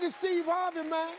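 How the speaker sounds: loud, high, exuberant hello to a buddy; hammed-up morning enthusiasm; overeager greeting. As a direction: greet him bursting with wide-awake energy. Acting newly enthusiastic phone answer.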